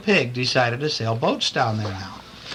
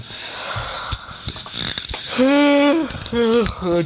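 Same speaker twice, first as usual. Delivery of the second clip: snoring sigh; 10 semitones higher than usual. Acted. Daddy falls asleep.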